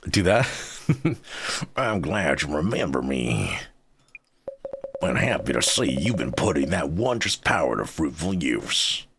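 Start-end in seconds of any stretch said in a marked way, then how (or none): 1.8-9.1 Solid Snake cowboy mix. Acted. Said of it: "solid cowboy".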